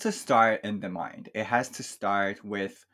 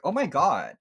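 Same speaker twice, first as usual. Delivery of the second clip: mock-astonished classmates, raised and louder; imitated shock; 5 semitones higher, 5 dB louder than usual. Retelling how people reacted to the higher score, mock shock. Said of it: "people were like".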